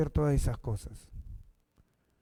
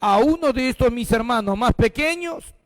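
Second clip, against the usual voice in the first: solemn preaching; 5 semitones higher, 5 dB louder than usual. Acted. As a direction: declare the king's verdict solemnly. Reads the king's words.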